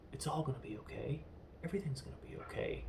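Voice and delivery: soft, quick imitation of soothing; hushed retelling of a man comforting his bride; gentle reassurance. Bond holding his dead bride, soft acted reassurance.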